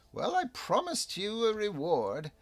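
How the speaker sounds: high goblin voice, grand and slowing on the magic; a pleased little goblin offering his treasure. Acted. Goblin Flizzleblin offers his cap as a reward, high and grand.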